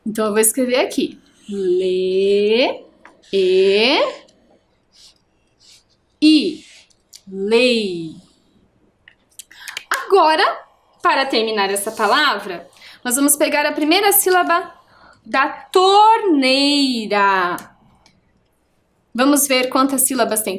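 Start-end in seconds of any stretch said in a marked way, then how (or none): 1.5-8.2 "L E I" in held letters with gaps, then "Lei"; slow spelling. Shown as a demonstration. Teacher writing the syllable lei, "L E I" spelled slowly. 15.2-17.6 "torneira" stretched very slowly on every syllable; drawn-out word. Shown as a demonstration. Teacher introducing the next word to pupils, "torneira" stretched out.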